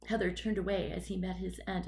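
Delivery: ordinary delivery, nothing marked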